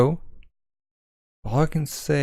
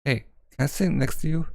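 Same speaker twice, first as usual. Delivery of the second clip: normal talking voice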